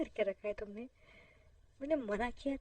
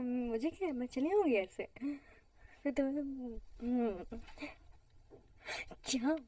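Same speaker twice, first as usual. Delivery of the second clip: ordinary talk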